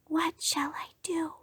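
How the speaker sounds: soft breathy whisper, no voice. Acted. The rabbit whispering his worry to a squirrel, whispered. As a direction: whisper it like you've lost your voice. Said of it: "he whispered to a squirrel".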